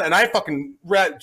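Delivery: ordinary delivery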